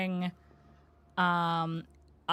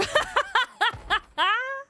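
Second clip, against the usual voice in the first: high burst of laughter; 5 dB louder than usual. Real, not acted. Laughing at ice cream claim.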